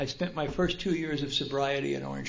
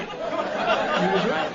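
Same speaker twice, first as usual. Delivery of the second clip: very slow, higher, laughing; rueful laugh; 10 semitones higher, 5 dB louder, much slower than usual. Real, not acted. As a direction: drag it out, laughing at yourself.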